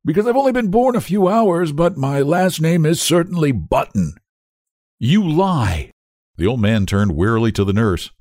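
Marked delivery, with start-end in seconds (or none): 0.0-4.1 put-on high, whining old-man voice; querulous whine. A narrator's reading. Old-man baby answers father, whining querulously. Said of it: "replied the querulous whine". 5.0-5.9 higher-pitched, indignant denial; accusing. A narrator's reading. Father rejects the old man's claim, indignantly.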